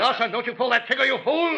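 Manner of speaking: terrified yell; frantic shout. Acted. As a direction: scream at him to stop. Carver facing a shotgun.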